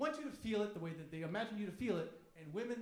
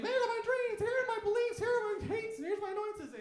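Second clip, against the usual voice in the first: high, loud mock girlfriend voice listing her dreams; 14 semitones higher, 9 dB louder than usual. Mocking the girlfriend who shares her dreams, high and loud. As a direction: play her up, high and eager. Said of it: "she's telling you all these things".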